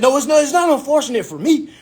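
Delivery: high-pitched indignant objection; hot-headed. Retold or imitated. Applicant disputing advisor's bad news, hot-headed. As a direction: argue back heatedly, retold. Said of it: "in my hot head stage".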